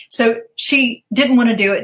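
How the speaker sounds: usual speaking voice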